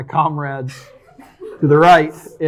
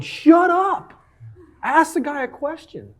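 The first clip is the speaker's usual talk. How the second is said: exasperated retold yell; 13 semitones higher, slower than usual. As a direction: yell it like you're fed up with the caller. Venting at a recorded call.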